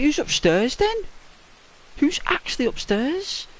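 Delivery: high, alarmed and urgent; panicked kid asking who is upstairs; spooked question. Retold or imitated. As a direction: ask like the hair on your neck just rose. Friend asks who is upstairs.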